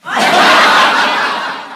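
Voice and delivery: loud breathy laugh bursting out; burst of laughter. Real, not acted. Teasing lover laughs loudly at his tired question.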